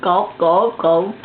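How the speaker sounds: burped out deep; burping the words. Real, not acted. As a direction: push the words out as a burp. Burping contest, cheering on.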